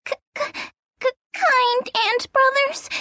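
stuttering from the cold; shivering. Acted. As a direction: teeth chattering on every k. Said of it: "she couldn't stop shivering".

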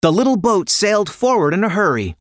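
ordinary delivery, nothing marked

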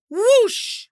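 ordinary delivery, nothing marked

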